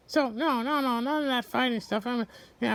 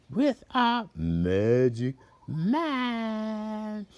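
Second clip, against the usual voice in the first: slow mystical; 5 semitones lower, much slower than usual. Acted. Mystical invocation.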